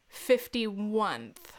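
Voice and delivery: very slow, falling repeat; deadpan disbelief. Retold or imitated. Quoting the caller's mistake, slow deadpan disbelief. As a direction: drag it out with finality.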